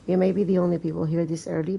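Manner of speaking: ordinary delivery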